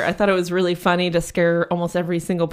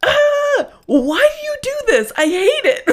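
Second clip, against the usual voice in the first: much higher, louder, whiny shriek of protest; startled manager; 5 dB louder than usual. Retold or imitated. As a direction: squeal like the scared manager caught off guard. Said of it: "And she's like".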